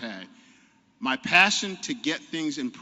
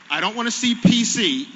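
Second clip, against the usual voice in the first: "see" pushed high and loud, falling; loud emphatic restart; 7 semitones higher, 8 dB louder than usual. Real, not acted. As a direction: hit "see" hard, louder. Restarts louder, pitched up.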